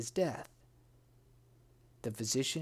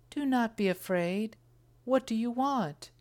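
higher-pitched ogress coaxing her prey; 8 semitones higher, 4 dB louder than usual. A narrator's reading. Ogress to the lost prince, high put-on voice. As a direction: speak up high, as the pretended princess. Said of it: "said the ogress".

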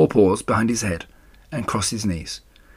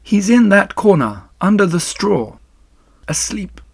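composed spider; 10 semitones higher than usual. Acted. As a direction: answer from a high, tired spider voice. Spider answers Wilbur's demand.

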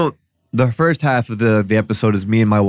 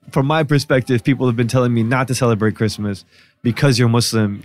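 usual speaking voice

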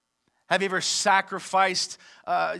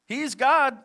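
mocking taunt; 7 semitones higher, 3 dB louder than usual. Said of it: "He began to mock them, saying".

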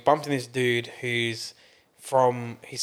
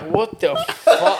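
laughing disbelief; 4 semitones higher, 5 dB louder, much slower than usual. Retold or imitated. Retelling shocked reaction, laughing.

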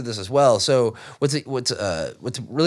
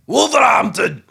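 hype shout; 10 semitones higher, 7 dB louder than usual. Acted. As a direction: shout Wolverhampton high and loud. Hyped shout of city name.

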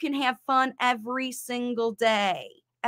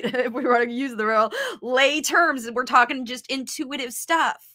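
usual speaking voice

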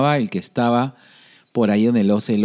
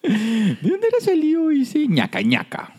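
high-pitched; 13 semitones higher than usual. Acted. High sustained playful question.

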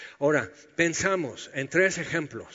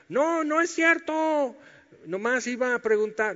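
sheepish backpedal; 8 semitones higher than usual. Pretend. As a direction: squeak out a guilty excuse.

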